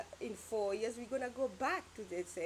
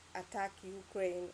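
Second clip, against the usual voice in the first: ordinary talk, nothing marked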